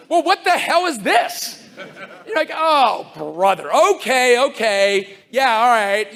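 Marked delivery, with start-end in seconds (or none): 0.1-1.6 higher, slow, laughing what-the-hell; a mock double take, half laughing; incredulous disbelief. Speaker reacting to the disclaimer in his talk video, incredulous and laughing. 2.5-6.1 louder, weary eye-roll sighs; exasperated resignation. Retold or imitated. Speaker grudgingly accepting the sponsor's disclaimer, exasperated.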